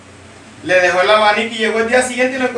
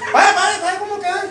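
mock posing guy; 9 semitones higher than usual. Muscle guy posing for photo.

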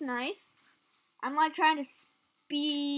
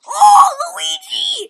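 very high, loud, slow wail; wailing shout; 17 dB louder, slower than usual. Real, not acted. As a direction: wail the name in despair. Crash at game end.

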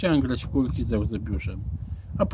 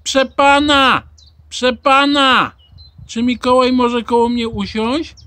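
high, loud call to the teacher; 9 semitones higher, 9 dB louder, slower than usual. A narrator's reading. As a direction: call across the canteen like an eager pupil. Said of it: "podniósł rękę i zawołał Rosoła". (głośne, wysokie wołanie)

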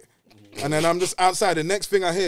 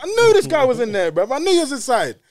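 raised voice shouting through the door; loud; 9 semitones higher, 8 dB louder than usual. Retold or imitated. Shouting at the man hiding inside. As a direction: shout it, certain he's inside.

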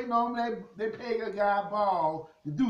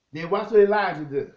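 usual speaking voice

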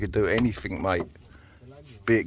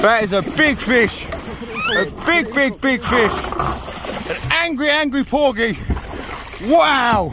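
much higher, excited cries over the catch; thrilled; 11 semitones higher than usual. Real, not acted. Landing the porgy, calling to Glenn, thrilled and high-pitched. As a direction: marvel at the size of it.

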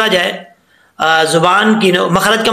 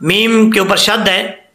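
ordinary delivery

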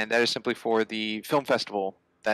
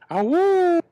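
high, loud howling whoop; wolf howl; 7 dB louder than usual. Pretend. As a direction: howl like a werewolf. Host in monster costume intro.

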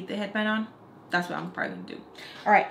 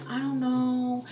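higher, stretched-out reluctance; mock hesitant; 4 semitones higher, much slower than usual. Makeup artist wavers over mom's invitation, drawn-out hesitation. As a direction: drag it out as if unsure about accepting.

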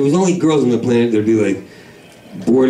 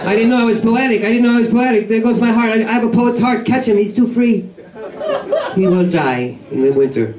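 high swooning poet voice, far up the register; flamboyant poet; 10 semitones higher than usual. Acted. Comic swooning to the crowd, high and flowery. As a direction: gush it like a dreamy lovesick poet.

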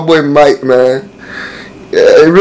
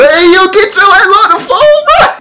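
higher, put-on voice of a friend; mock friend; 13 semitones higher than usual. Retelling what his friend said on the phone, mock voice. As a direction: imitate him barking the order. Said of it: "He said".

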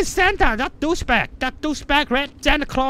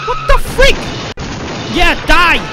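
heated yelling; 6 dB louder than usual. Real, not acted. Player fighting the imposter Santa, heated shouting.

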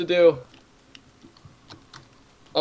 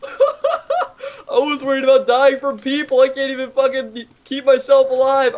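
laughing; 7 semitones higher than usual. Real, not acted. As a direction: giggle it out, still shaken. Laughing after spider scare.

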